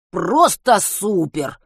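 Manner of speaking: sarcastic. A narrator's reading. Sarcastic reaction to lost torch.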